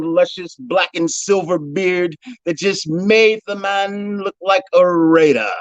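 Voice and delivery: ordinary talk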